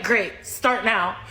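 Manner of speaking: low, slow, firm demand; curt retort. Retold or imitated. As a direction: lay it down slowly to end the pestering. Curt retort to pestering man.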